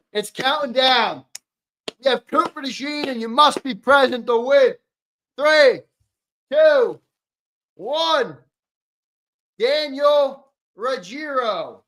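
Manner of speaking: high, loud, drawn-out announcer call; giveaway announcer. Acted. Streamer calling a jersey winner to the chat, big announcer voice. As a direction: call out the draw like a hype announcer.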